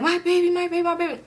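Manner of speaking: very high mock whimper; anguished high-pitched cry; imitated distressed wail. Pregnant woman squatting by her car, imitated distress.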